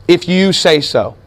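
slow, noncommittal reply leaning on "you"; unhurried, "you" stressed over "say so". Shown as a demonstration. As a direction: draw it out, putting the weight on "you". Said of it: "emphasizing the word you".

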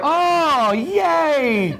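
very high, drawn-out delighted cheer; hammed-up "hooray" for the class; gushing cheer. Teacher cheering the class's answer after asking for enthusiasm, gushing.

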